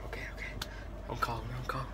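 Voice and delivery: whispered, scared and hushed; nervous whisper. Real, not acted. Vlogger whispering nervously as he calls his cousin, hushed. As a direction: whisper it so nobody hears you.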